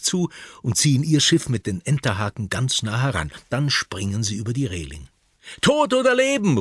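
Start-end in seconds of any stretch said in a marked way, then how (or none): 5.6-6.6 hoch und laut, TOD betont; brüllt den Piratenspruch heraus; lauter Ruf. Acted. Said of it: "ruft Püppi". (Püppi ruft beim Entern, laut)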